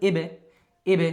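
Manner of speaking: "এবে" as ইব with the ব bounced; qalqalah bounce. Shown as a demonstration. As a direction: release ব with a short echo in "এবে". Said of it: "একটু ধাক্কা দিই". (ধাক্কা দিয়ে "এবে")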